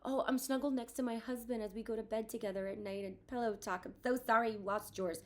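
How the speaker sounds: a put-on sugary voice; mocking sympathy. Grieving mom mocks a tactless person's pity, sugary. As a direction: parody someone tactlessly sweet.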